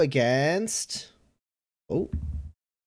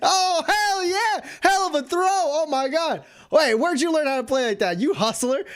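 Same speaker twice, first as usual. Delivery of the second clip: much higher, thrilled cheering; excited bartender; 11 semitones higher than usual. Acted. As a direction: bartender cheering a lucky dart throw. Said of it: "He's like".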